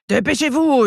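high-pitched yell at contained volume; ogress yell. A narrator's reading. Ogress hurrying the elves, high mock yell. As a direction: holler it high, without real loudness. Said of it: "hurle Burke".

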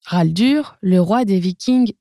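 ordinary delivery, nothing marked

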